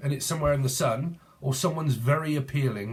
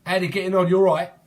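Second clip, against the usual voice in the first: put-on higher, louder cheeky London accent; British accent; 5 semitones higher, 6 dB louder, faster than usual. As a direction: greet the kid in a broad London accent. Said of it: "But I'm like".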